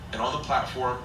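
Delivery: ordinary delivery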